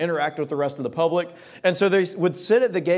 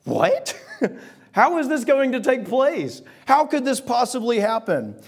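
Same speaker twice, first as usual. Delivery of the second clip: laughing in disbelief, higher; incredulous laugh; 7 semitones higher than usual. Retold or imitated. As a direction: laugh it off as impossible.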